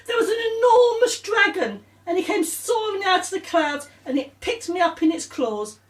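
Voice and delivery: very high, excited, rising drama; dramatic storytelling. Acted. Claire telling Samantha about the dragon, excited. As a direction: make the dragon sound huge.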